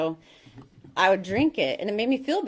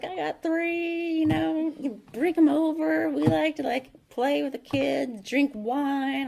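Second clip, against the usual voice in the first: much higher, slow Southern neighbor voice; Southern accent; 9 semitones higher, slower than usual. Retold or imitated. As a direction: imitate the Southern neighbor, high and drawn out. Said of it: "in her little Southern accent".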